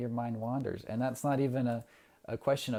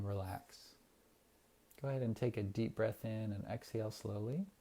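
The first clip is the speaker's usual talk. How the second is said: guided meditation. Acted. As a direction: ease the listeners into calm breathing. Leads a breathing meditation.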